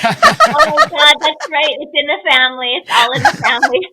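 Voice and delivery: high, loud delighted exclaiming; excited squeal. Real, not acted. Guest recalls the old couch, high loud delight.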